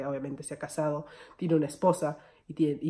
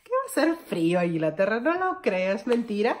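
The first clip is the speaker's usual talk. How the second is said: scoffing disbelief; 6 semitones higher, 4 dB louder than usual. Retold or imitated. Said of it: "porque dije".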